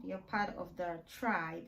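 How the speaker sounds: ordinary talk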